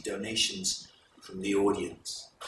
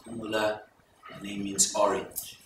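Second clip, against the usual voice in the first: usual speaking voice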